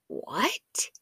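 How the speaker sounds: very soft, rising question; retold shock in a tiny voice; small, incredulous question sliding upward; stunned disbelief. Retold shock at heart murmur news.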